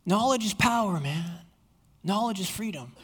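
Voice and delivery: dropped to a secretive hush; hushed sly murmur. Acted. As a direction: let the pitch fade into a confiding hush. Satan's pitch closing, voice dropping.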